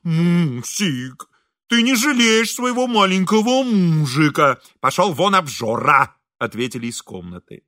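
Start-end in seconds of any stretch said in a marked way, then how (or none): 0.0-1.3 long-drawn coo through a pout; pouted-lips pleading. A narrator's reading. Hungry husband answering his scolding wife, pouting. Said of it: "свернувший уже привычные губы в трубочку". 1.7-4.6 high, loud husbandly complaint; pleading whine. A narrator's reading. Hungry husband begging his wife for pity, whining. 4.8-6.2 scolding. A narrator's reading. Wife snaps back from room.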